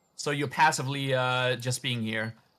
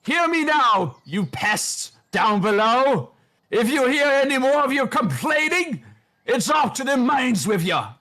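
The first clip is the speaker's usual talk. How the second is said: much higher, louder hammed-up bellow; old man shouting; 11 semitones higher, 4 dB louder than usual. Old man puppet threatening the peasants below, a loud bellow. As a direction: roar down at the pests. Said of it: "as he shouts".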